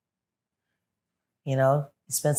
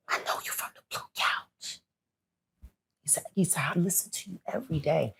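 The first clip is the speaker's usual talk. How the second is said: soft whispered confession from a fan; whispering fan; 4 semitones higher, 6 dB quieter than usual. Retold or imitated. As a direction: hush it like a shy admirer.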